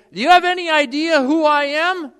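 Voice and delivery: a sinner puffed up on Judgment Day; proud protest. Retold or imitated. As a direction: demand your due before God. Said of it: "you'll say".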